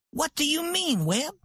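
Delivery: a sharp, surprised what do you mean; surprised wife. A narrator's reading. Santa surprised by her husband's order, sharp and high.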